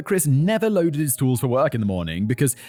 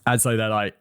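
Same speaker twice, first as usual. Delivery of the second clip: usual speaking voice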